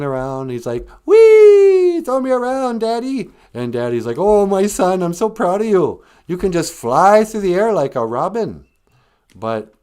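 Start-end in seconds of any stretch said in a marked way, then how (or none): 1.0-3.3 very high, loud squeal of delight; gleeful child. Acted. Boy swinging on the trapeze calls to Daddy, gleeful child. 4.2-8.6 loud, high, gushing dad voice; proud father. Acted. Circus dad to his swinging son, proudly gushing.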